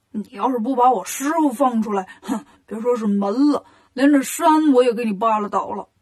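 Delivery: a lightly put-on pig-demon voice, gruff threat; mild bragging threat from Bajie; Bajie threatening. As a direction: puff up like a boastful pig spirit. 八戒威胁妖精.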